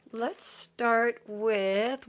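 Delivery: ordinary delivery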